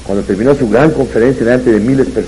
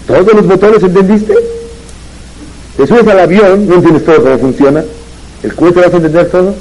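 exasperated scolding; 7 semitones higher than usual. Retold or imitated. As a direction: scold him like he's hopeless. NASA guide scolding the visitor.

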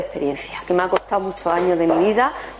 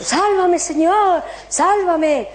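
pleading cry; 8 semitones higher, much slower than usual. Retold or imitated. Drowning man begging the Lord, high pleading. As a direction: plead desperately to be saved.

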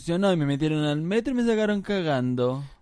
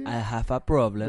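slow, lowered mock-sad English; put-on sorrow; 3 semitones lower, slower than usual. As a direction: drag the confession out mournfully. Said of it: "es parte de la pena". (pena exagerada, lenta y grave)

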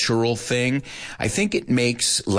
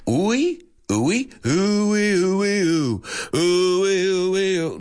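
high, drawn-out uy cries; repeated mock uy; 8 semitones higher, much slower than usual. Host mimicking the puzzling Spanish uy, high groans. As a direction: keep groaning uy over and over. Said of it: "what is this uy thing".

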